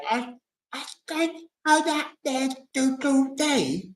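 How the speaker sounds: put-on high little child's babble, "do, day"; toddler voice. Parent retelling a toddler's babble, high childish voice. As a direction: squeak it out like a small kid learning to talk. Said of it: "the child might say".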